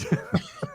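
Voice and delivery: squeaky laugh; high burst of laughter. Real, not acted. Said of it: "I'm laughing".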